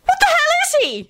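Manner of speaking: very high-pitched shriek. A narrator's reading. Rosie asks what Scully is, shrieking. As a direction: shriek the question at the top of your range. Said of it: "she shrieked".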